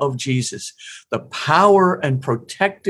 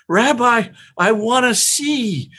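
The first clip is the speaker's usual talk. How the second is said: pleading cry, pitched high and louder; blind man calling out; 9 semitones higher, 4 dB louder, slower than usual. Retold or imitated. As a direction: call out to the rabbi, begging to see.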